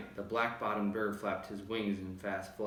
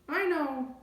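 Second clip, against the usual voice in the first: very high little call; squeaky call; tiny calling voice; 4 dB louder than usual. A narrator's reading. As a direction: call up from the speck. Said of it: "called the voice".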